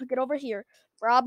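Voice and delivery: ordinary talk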